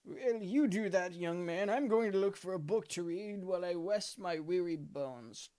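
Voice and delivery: put-on creaky old gent, unhurried; a frail elderly host; old man voice.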